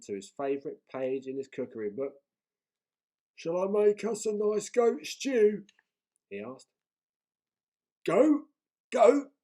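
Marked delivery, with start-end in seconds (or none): 3.4-5.7 high, loud, slow troll offer; troll proudly offering his dish; eager troll. A narrator's reading. Troll voice in read story. 8.0-9.3 high, loud rising question; pirate chief appalled at the menu; shocked disbelief. A narrator's reading.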